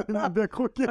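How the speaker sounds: high, laughing through the words. Real, not acted. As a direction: crack up at your own expense. Both laugh at the pun.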